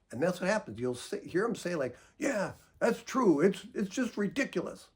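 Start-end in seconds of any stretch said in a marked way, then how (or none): 2.2-4.9 mock exasperated complaint. Angry partner quoted.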